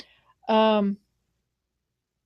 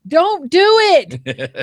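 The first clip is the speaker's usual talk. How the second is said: loud, high, long-drawn plea; urgent mock warning; 8 semitones higher, 9 dB louder, much slower than usual. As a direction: cry it out slowly as a warning. Acting out people's warnings about Kansas.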